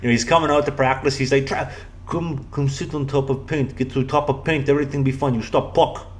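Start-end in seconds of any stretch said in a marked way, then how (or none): 1.5-6.1 a hint of a Russian accent, broken English; mock Russian coach. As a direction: imitate the pushy Russian coach in a light Russian accent. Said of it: "this crazy Russian bear".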